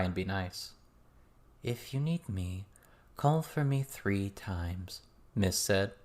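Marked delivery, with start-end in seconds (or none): 1.6-5.0 slow, knowing voice; a hint of a sly guide; lightly voiced mysterious woman. A narrator's reading. Miss turning to the young practitioners, slow and knowing. Miss's voice.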